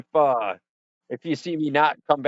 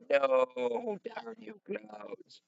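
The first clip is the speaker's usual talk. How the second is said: high, drawn-out, stifled mock shout; hammed-up distant yell, slow and soft at the end; 11 semitones higher, 4 dB quieter, much slower than usual. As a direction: curse the clouds in a small strained voice. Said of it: "Shouting at the clouds".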